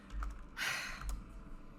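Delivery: long, soft exhale; weary sigh. Acted. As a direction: take a breath and let the tension go. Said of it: "She just lets out a sigh".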